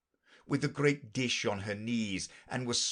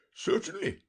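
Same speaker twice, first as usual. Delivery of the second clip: nervous king; 12 semitones higher than usual. Acted. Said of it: "the king muttered".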